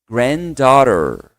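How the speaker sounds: Granddaughter stretched slowly as grand-daw-ter; slow pronunciation demo. Shown as a demonstration. Teacher drills class on Granddaughter, very slow model. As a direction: drag out Granddaughter syllable by syllable. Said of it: "Então vai ficar assim, ó".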